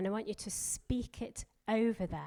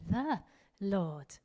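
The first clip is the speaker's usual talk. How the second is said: "The" said alone and raised, then a pause; stress on The. Shown as a demonstration. Preacher models a different emphasis each day, stressing "The". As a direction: punch "The", pause, then drop onto "Lord". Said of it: "Emphasize a different word every day".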